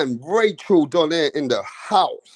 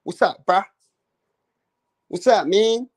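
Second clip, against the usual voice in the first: mock American; 3 semitones higher than usual. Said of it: "Certain states probably use bruh".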